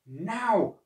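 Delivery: acted dog's signal to charge; barked command. A narrator's reading. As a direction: bark the order to attack. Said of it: "barked the dog".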